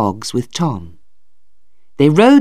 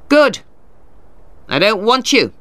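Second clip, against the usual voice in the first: higher, sharp dismissal; scornful retort; 6 semitones higher than usual. A narrator's reading. Lawyer answers Tulliver's defiance, scornful. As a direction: dismiss him with raised pitch.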